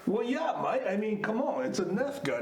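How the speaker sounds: hammed-up Australian accent; Aussie accent. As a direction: play it as a comic Aussie. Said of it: "Keep the accent if you like".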